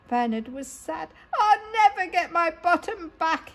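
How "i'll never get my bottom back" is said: high mock sob, a little louder; sobbing. Sad robot to his friends, high mock sob. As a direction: wail it like a sad child. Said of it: "he sobbed".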